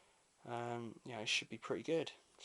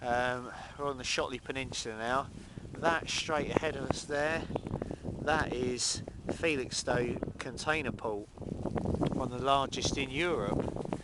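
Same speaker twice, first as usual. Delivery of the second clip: raised voice over gusts; shouting over wind; 2 semitones higher, 8 dB louder than usual. Real, not acted. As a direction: call out above the wind. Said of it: "Don't know if you can hear me over the, over the wind here".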